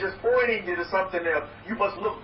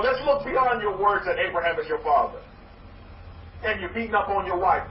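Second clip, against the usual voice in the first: ordinary talk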